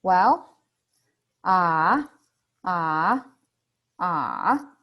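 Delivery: "Well" and "ah" dipping, then rising; third tone, thinking. Shown as a demonstration. Patient thinking it over, dipping then rising. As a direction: ponder on "ah", sinking and lifting. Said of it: "You need to think about it".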